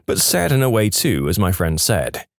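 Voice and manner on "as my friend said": usual speaking voice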